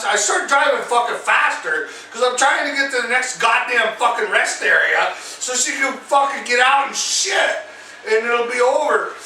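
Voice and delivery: ordinary delivery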